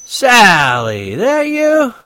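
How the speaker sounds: put-on high, loud, slow drunken bellow; drunken shouting. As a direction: slur it loud into the phone, very drunk. Drunk Holden.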